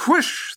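high imitated whoosh. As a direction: make the rush of something flying past. Imitates arm flying past.

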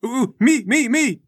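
high, loud, excited squeal; eager volunteer. Acted. Sasha volunteering before the crowd, eager squeal. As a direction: beg to be picked. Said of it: "She throws her hand up to volunteer".

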